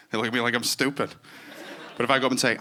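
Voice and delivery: ordinary talk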